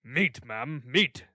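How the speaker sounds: sternly punched "meat", repeated as a verdict; stern emphasis. A narrator's reading. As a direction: land hard on each "meat". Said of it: "replied Mister Bumble with stern emphasis".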